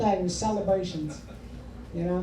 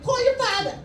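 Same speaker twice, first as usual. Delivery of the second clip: much higher, eager mom; mom thrilled; 13 semitones higher, 3 dB louder than usual. Retold or imitated. Mom gleefully sending son to tell dad, high voice. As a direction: urge him to share the joy. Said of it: "She's like".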